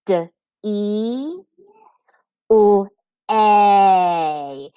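"D E U A" as duh, ee, lll, long ay; sounding out. Shown as a demonstration. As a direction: hold each sound apart in "D E U A". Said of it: "two syllables".

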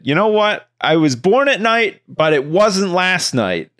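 high-pitched mock-stern parent, slowing at the end; retold parental put-down. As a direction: retell your comeback to a cheeky kid. Parent telling off cheeky kid.

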